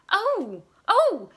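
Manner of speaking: high, loud yelp like a singed cat; startled cry. A narrator's reading. Della suddenly remembering Jim's gift, high loud cry. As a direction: leap up crying out. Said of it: "leaped up like a little singed cat and cried".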